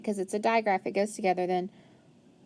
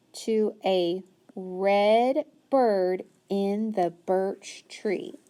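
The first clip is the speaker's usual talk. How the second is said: slow rereading; much slower than usual. Shown as a demonstration. Teacher rereading to find the answer, slowly. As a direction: read back slowly, word by word. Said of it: "let's go back".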